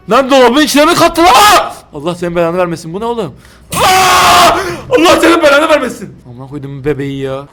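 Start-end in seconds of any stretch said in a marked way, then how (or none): 0.1-1.7 loud, high, slow disbelief; shocked. Real, not acted. Shocked at baby in fridge. 3.7-6.2 high, loud cursing yell; terrified shout. Real, not acted. Jump scare.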